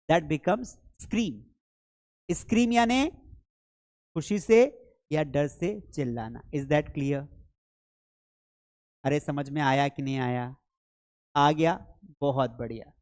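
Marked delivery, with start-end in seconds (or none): none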